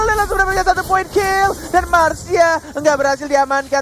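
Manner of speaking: fast, loud play-by-play hype; esports caster. Real, not acted. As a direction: call the fight like a caster. Live play-by-play of fight.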